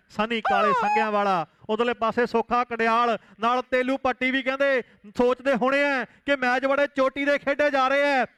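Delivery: usual speaking voice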